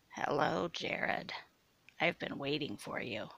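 low-energy, rasping menace; coarse like sandpaper. A narrator's reading. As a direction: rasp it softly, like sandpaper. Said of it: "her voice now coarse like sandpaper".

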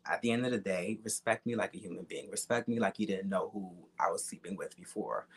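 normal talking voice

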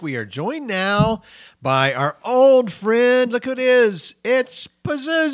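high, slow, loud hype; excited announcement. Acted. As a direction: hype up the guest. Announces the demon guest.

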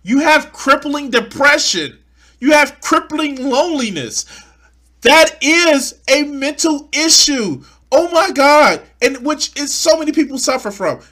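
loud disbelief at the screen; exasperated rant. Real, not acted. Ranting back at the video.

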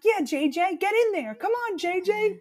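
high, cheering call; mock cheering. Friends urge a shy guy into the photo, high teasing cheer. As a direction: egg him on into the photo. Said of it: "ten of us screaming".

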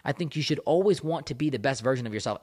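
ordinary talk

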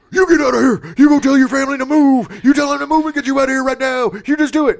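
loud, high-pitched frantic orders; hammed-up overacted shouting, rushing toward the end; mock yelling. Host imitating Bill ordering a kid to leave town, frantic mock yell. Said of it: "he's, like, yelling at".

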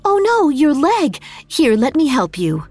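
alarmed concern. Acted. Otoyo sees the wound.

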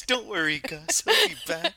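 high, loud mock baby talk to a dog; imitating pet talk. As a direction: mimic her cooing at the dog. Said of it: "Brian's going through this and you're".

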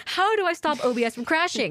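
put-on pleading question; mock helpless searcher. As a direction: act out a clueless forum search. Acts out frantic Reddit search.

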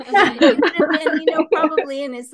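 laughing it out loud and high. Real, not acted. Telling daughter about the old Scottish guy, laughing. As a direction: breaks into loud laughter mid-sentence. Laughing while recalling his age.

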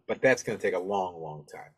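ordinary talk, nothing marked

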